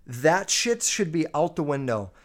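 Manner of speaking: normal talking voice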